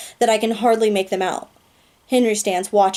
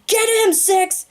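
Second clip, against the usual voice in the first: urgent shout; 13 semitones higher than usual. Acted. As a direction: yell it across the gym. Screaming orders in battle.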